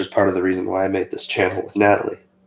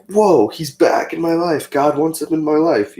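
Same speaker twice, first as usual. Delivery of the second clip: raised, thrilled inner voice; excited inner thought; 7 semitones higher, 3 dB louder than usual. Retold or imitated. Teen thrilled his teacher is back, excited higher. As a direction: gush it out higher like a kid. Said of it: "I was just like".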